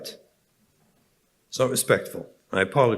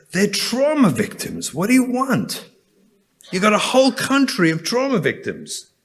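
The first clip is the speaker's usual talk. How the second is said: impassioned preaching; 10 semitones higher than usual. Acted. Rabbi telling the congregation, rising impassioned preaching. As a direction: let the outrage rise in every line. Pleading for the passengers.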